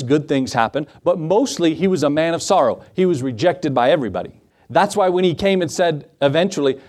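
ordinary talk, nothing marked

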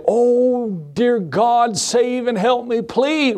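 high, drawn-out begging; mock desperate plea. Preacher acting out a plea Nathaniel didn't make, mock desperate. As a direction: plead melodramatically, stretching out the words.

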